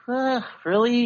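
ordinary talk

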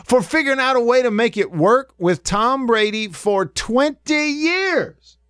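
high-pitched disbelief climbing at the end; indignant, voice shooting up on "twenty years"; incredulous. Real, not acted. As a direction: protest in high, baffled disbelief. Incredulous rant at the caller's blame.

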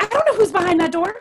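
fast worried explanation; mother voicing her fear for her son; alarmed. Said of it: "She's like".

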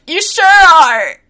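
high, loud, slow playful agreement; playful reply to kid. Retold or imitated. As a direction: draw it out high, playing along with the kid. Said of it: "and I'm like".

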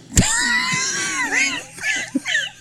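a high squealing laugh burst; high shrieking laugh. Real, not acted. Laughing at joke.

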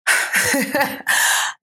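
a long, drawn-out exasperated sigh out on the breath; long heavy sigh. Real, not acted. Recalling the false accusation, a heavy worn-out sigh. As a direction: let it all out in one tired sigh.

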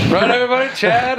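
high-pitched appeal to the room; bartender calling out. Acted. Bartender asks the whole bar to agree, high calling voice. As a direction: pitch it way up, rallying the regulars.

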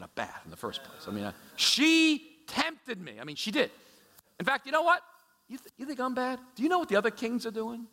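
high, rising, hammed-up self-justification; mock a king talking himself out of blame; defensive excuses. Preacher acts out a king blaming others, hammed-up and defensive. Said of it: "He doesn't say".

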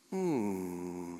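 low, soft drawn-out hmm; quiet, chastened hmm; pondering hum. Acted. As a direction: hum low as if taken aback. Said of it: "Peter in that moment just was like".

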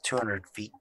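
usual speaking voice

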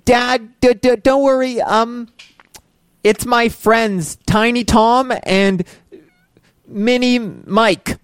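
put-on kid voice. Kid character talks to dad.

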